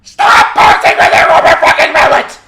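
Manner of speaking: extremely high, loud shriek; raging scream. Real, not acted. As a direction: scream it at full force. Screaming at the game.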